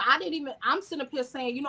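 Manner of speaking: ordinary talk, nothing marked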